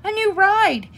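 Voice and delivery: put-on loud, high-pitched little girl cry; excited shout. A narrator's reading. Sister bear pointing out a new ride, shouting. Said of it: "shouted Sister".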